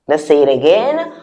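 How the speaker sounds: normal talking voice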